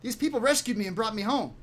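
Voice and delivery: put-on high, stammering boy's voice; high-pitched kid, tripping over words; kid in character. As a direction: explain yourself as a rattled youngster. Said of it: "Uh, the kid says".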